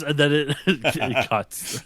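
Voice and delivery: normal talking voice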